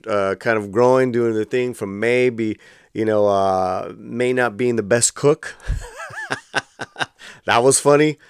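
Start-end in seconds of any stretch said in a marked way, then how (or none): 5.6-7.0 a quick real laugh, high and soft; short laugh. Real, not acted. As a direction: let a small laugh escape. Laughing at character's cooking.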